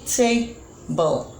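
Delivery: "table" split into two units, ta... ble; syllable by syllable. Shown as a demonstration. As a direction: break "table" after the first syllable with a short pause. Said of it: "we tried to pronounce it".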